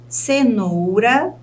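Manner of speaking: slow "cenoura", NOU held, rising; stressed syllable exaggerated. Shown as a demonstration. Teacher has students find the stress, "cenoura" drawn out on NOU. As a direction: stretch "cenoura" on its middle syllable. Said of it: "tente analisar qual dessas sílabas é a sílaba tônica".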